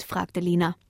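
ordinary delivery, nothing marked

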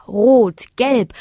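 ordinary delivery, nothing marked

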